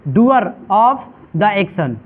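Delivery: "Doer of the action" very slowly, word by word; slow, spaced-out repetition. Shown as a demonstration. Teacher giving the class the answer "Doer of the action", slow spaced-out repetition. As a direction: draw out "Doer of the action" with gaps between words.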